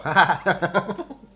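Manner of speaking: loud, high laugh that rises; burst of laughter. Real, not acted. Streamer reads something hilarious in chat, loud high laugh. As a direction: break into a loud surprised laugh.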